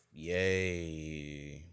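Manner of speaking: a flat, deadpan yay; bored. Retold or imitated. Unenthused reply to starting the adventure, bored. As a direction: say it with zero enthusiasm. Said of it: "she says it very bored".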